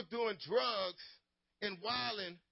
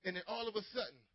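ordinary talk, nothing marked

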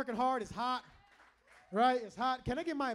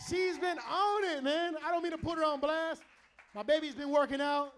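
raised, excited boast about his wife; proud bragging; 3 semitones higher, 5 dB louder than usual. Real, not acted. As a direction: brag on her loudly to the room.

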